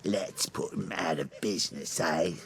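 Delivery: low, sneering Cockney accent put on to mock; lightly aping a London gangster's Cockney accent. Joker mocking the Penguin's Cockney accent. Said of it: "Do you believe that accent?".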